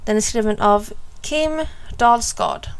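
ordinary delivery

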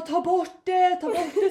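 high, loud mock-panicked plea with laughter; retold panic. Telling how she begged Frida for help, mock panic. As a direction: reenact a frantic cry for help, half laughing. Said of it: "fick panik, jag bara".

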